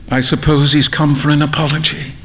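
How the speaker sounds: ordinary delivery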